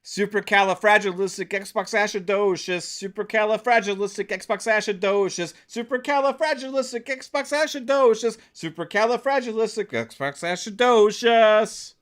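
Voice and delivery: triumphant, escalating word flex; showing off. Shown as a demonstration. Repeats long word, hamming it up.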